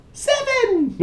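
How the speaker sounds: gleeful yell; triumphant shout. Real, not acted. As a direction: blurt it out like a winning answer. Counting months, punchline.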